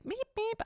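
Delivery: a light squeaky honk sound; car horn imitation. Host mimicking cars honking as they pass, high light beeps. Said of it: "doing more sounds than I've ever done".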